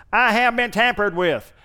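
lightly voiced "I have been tampered with" like a robotic alarm; car alarm voice. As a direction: mimic a tinny car alarm, only a hint. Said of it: "a real tinny sounding voice going".

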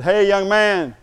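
loud call across the street; shouting to get his attention. Retold or imitated. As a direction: call after him down the street. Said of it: "And I yelled at him".

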